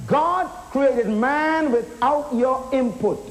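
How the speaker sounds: usual speaking voice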